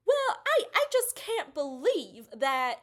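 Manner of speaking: put-on high, drawn-out Southern accent, slowed; a lightly hammed Southern council member, slightly slow. Council member reacting to the scene, Southern accent. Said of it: "they all talk in the Southern accent".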